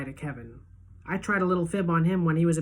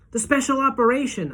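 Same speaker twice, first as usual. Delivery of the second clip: higher, louder accusing cry; angry accusation; 9 semitones higher, 6 dB louder than usual. A narrator's reading. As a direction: hurl it at the doctor. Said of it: "I say".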